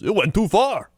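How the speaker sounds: high, punchy Chicago radio ad voice; Chicago ad guy. Retold or imitated. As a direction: deliver the ad tag line like a Chicago pitchman. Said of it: "a Chicago guy".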